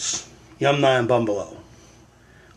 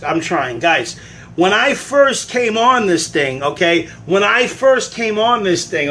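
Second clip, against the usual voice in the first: heated pleading rant; 4 semitones higher than usual. Real, not acted. Host ranting at viewers who don't get it, worked up.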